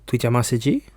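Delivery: lightly put-on mock Chinese gibberish. Owner talking to his dog in mock Chinese gibberish. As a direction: fake Chinese words to the dog. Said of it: "I'd look at him and go".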